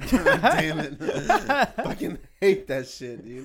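real loud laughing through the words; laughing exasperation. Real, not acted. Friend cracking up over a pet peeve, laughing protest. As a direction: curse it slowly while cracking up.

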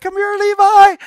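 high, loud, coaxing call; calling a toddler. Retold or imitated. Wife calling baby Levi across the living room, high coaxing call. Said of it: "she said".

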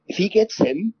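high, shocked retold line; imitating a stunned friend; quoting Mo. Mo's reaction quoted in story.

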